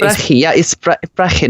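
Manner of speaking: "Brechi" and "brechi" with the ch rasped in the throat; Scouse pronunciation. Shown as a demonstration. Putting the hosts right on the Scouse word, throaty "brechi". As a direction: correct them, scraping the ch of "brechi". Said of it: "not tobacco".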